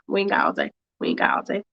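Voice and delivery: very low, gruff hurry-up; deep hurry-up voice. Acted. Speaker rushing the story along, in a very low rumble. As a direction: drop into a giant's rumble to rush them.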